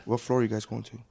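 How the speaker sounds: soft elevator small talk; elevator passenger. Pretend. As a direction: ask it quietly, as if riding an elevator. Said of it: "That's elevator".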